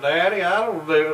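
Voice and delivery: high, loud imitation Southern twang; twangy Southern accent. Kid protesting to father, imitated twangy Southern accent. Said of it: "they'll be straight talking like this".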